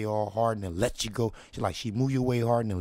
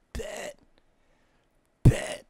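hushed then shouted; 7 dB louder than usual. Retold or imitated. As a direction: hold it in, then burst out. Soft then loud bet.

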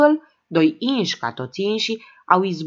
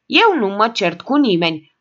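louder, insistent declaration; emphatic loud claim; 4 semitones higher, 6 dB louder than usual. A narrator's reading. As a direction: insist loudly you never fight. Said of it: "diapazonul respectiv".

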